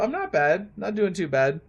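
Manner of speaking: ordinary talk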